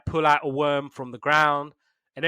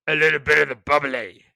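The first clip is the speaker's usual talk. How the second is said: louder, mock-indulgent delivery; playful put-on voice; 7 dB louder than usual. Podcaster hints the mouse drinks champagne, playful put-on voice. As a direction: relish the phrase in a silly voice.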